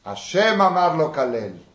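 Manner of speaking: king silencing his general; stern rebuke. Retold or imitated. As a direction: cut him off sharply.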